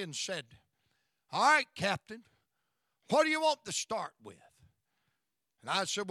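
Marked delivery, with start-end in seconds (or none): 1.3-4.4 hearty call. Retold or imitated. Song leader greets the preacher.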